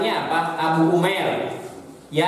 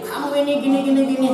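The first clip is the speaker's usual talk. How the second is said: hammed-up scolding of a little brother, pitched up; mock scolding, higher; 7 semitones higher, much faster than usual. Big sibling scolding little brother, mock scolding. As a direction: play a big sibling nagging, raised pitch.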